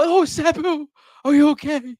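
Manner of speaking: high cooing concern; mock doting owner. Mocking the fussing owner who asks the cat if it's okay. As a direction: fuss over the cat like a baby. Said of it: "we don't go like".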